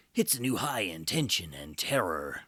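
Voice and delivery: loud, hammed-up old movie trailer announcer; goofy trailer voice. Reading the old-fashioned tag on the box, goofy trailer voice. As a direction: ham it up like a goofy trailer voice-over. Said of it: "doing my goofy trailer voice on this".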